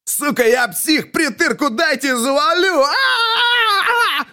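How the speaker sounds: very high crying shriek rising to a scream; a terrified high scream that breaks into crying; squealing hysterically. A narrator's reading. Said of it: "завизжал".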